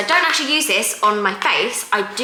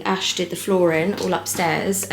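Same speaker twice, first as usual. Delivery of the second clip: normal talking voice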